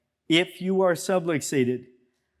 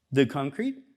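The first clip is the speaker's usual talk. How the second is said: normal talking voice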